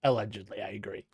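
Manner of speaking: mock legal whisper. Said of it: "legal hedge joke".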